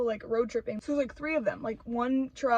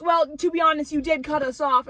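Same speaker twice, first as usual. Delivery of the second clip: snippy wife; 5 semitones higher, 8 dB louder than usual. Retold or imitated. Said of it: "And she goes".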